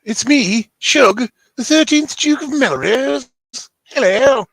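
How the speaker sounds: high, loud theatrical announcement; grand self-introduction. Pretend. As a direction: proclaim your noble title with a drawn-out flourish. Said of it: "I'll let Shug say his name, 'cause I can't do the accent".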